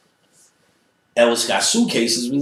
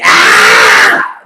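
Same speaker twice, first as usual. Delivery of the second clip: loud screaming ah; lady's scream; 10 semitones lower, 12 dB louder than usual. Retold or imitated. Lady seeing the fight, a loud scream. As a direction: scream in alarm.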